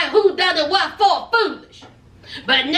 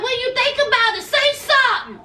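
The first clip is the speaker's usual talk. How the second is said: imitating Natalie; 7 semitones higher than usual. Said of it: "she was like".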